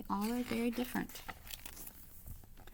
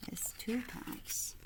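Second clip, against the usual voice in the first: whisper; 5 semitones higher, 6 dB quieter, slower than usual. Acted. Answering her own math question.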